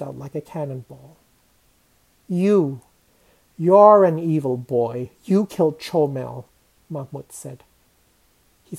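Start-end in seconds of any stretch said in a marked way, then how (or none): 2.3-6.5 forceful, louder condemnation; indignant accusing outburst; angry accusation. Acted. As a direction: hurl the blame with weight on each phrase. Mahmud accuses narrator of killing cat.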